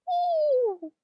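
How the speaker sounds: very high, breathless ooh; awed ooh. Retold or imitated. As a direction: coo in amazement. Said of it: "To which Cinderella responds".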